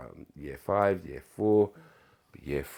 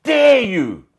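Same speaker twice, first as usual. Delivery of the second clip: imitating angry teacher; 18 dB louder, much slower than usual. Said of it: "and she".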